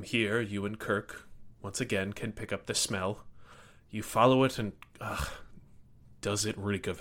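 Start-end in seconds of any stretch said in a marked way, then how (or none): none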